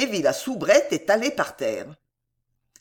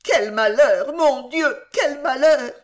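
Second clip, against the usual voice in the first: high, louder lament in tears; tearful wailing; 6 semitones higher, 5 dB louder than usual. A narrator's reading. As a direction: wail it through tears. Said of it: "pleurait à chaudes larmes et gémissait".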